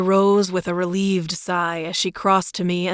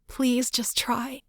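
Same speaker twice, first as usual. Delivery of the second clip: soft, slow, higher plea; begging; 6 semitones higher, 5 dB quieter, slower than usual. A narrator's reading. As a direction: beg gently, almost breaking. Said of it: "she begged".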